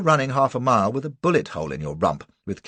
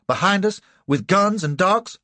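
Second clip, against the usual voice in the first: crying out; 8 semitones higher, 5 dB louder than usual. A narrator's reading. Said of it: "cries Cassie".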